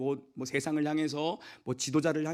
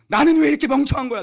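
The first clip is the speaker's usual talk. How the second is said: loud, high frustrated yell at oneself; self-scolding shout; 11 semitones higher, 11 dB louder than usual. Retold or imitated. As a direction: curse your own clumsiness. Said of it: "내가 막 그랬거든".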